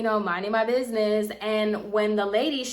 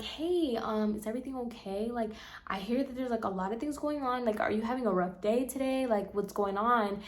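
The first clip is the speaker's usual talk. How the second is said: gentle concerned teacher; 8 dB quieter than usual. Retold or imitated. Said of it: "she was very nice".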